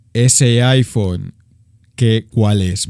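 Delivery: low, very slow, loud thug demand; menacing robber. Retold or imitated. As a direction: drag every word out low and heavy. Robber asks about the phone. (ladrón exigiendo el teléfono, lento y grave)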